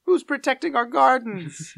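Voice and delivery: high, loud imitated cartoon cry; mock-panicked bystander voice. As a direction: shout the question way up in pitch. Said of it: "[name] throwing in an additional voice".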